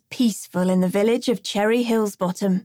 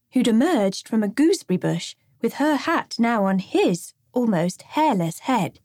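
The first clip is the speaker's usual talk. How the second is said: usual speaking voice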